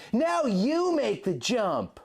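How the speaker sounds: high far shout, slow and drawn out; rally her to jump; Link yelling. A narrator's reading. Link calling Jenna to ride for the fences, high and slow.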